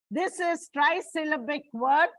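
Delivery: ordinary talk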